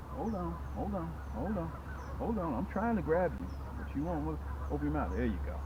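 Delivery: soft, murmured sweet talk to a fish; quiet coaxing. Real, not acted.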